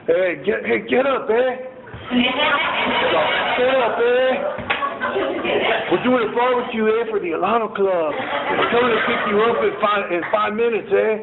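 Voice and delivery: high, bossy put-on voice ending each bit with "eh"; sponsor's pushy voice. Old sponsor wakes him at dawn, bossy high put-on voice. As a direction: play the tough old sponsor barking orders cheerfully.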